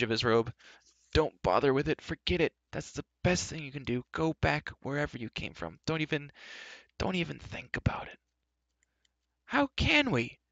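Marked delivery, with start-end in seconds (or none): 1.1-8.2 higher, anxious dissuading; flustered, hushed protest; choked. A narrator's reading. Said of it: "Gwistle choked". 9.4-10.4 very high, exclaimed protest; impassioned high outcry; crying out. A narrator's reading. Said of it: "Terran cried".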